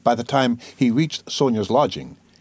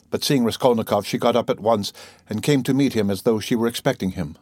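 ordinary delivery

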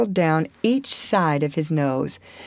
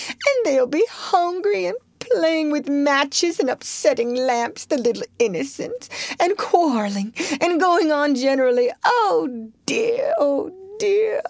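much higher, crying put-on woman's voice; sobbing washerwoman; 9 semitones higher than usual. A narrator's reading. Toad as washerwoman lamenting her children, high crying voice. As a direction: wail the worries out tearfully, high. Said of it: "sobbed Toad".

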